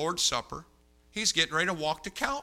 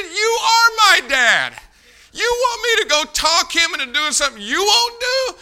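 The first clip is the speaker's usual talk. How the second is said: outraged shout; 12 dB louder than usual. Retold or imitated. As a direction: yell it in exasperated disbelief. Acted outburst at his friend.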